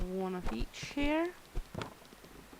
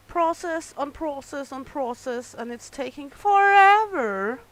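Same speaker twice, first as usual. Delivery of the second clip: exasperated outburst; 4 semitones higher, 9 dB louder than usual. Real, not acted. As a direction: blurt it out, sick of waiting. Slow order, complaining to customer.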